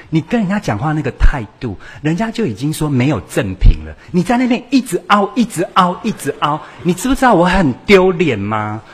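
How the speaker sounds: put-on embarrassed child's rant. A teenager loudly complains his mother embarrassed him while shopping. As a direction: nag her like a mortified teenager.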